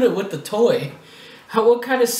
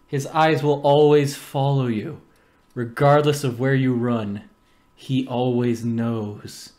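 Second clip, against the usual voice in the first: spooky storyteller; 7 semitones lower than usual. Acted. Creepy spooky build-up.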